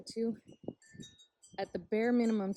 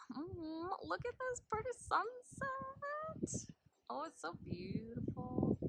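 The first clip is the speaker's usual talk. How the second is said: soft awe; 11 semitones higher, 6 dB quieter, slower than usual. Real, not acted. Admiring the sunset.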